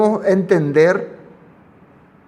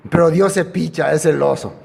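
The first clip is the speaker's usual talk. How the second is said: ordinary delivery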